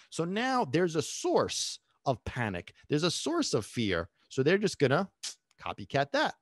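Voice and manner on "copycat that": normal talking voice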